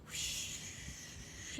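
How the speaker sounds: usual speaking voice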